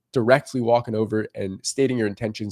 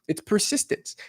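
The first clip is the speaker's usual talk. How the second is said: ordinary talk, nothing marked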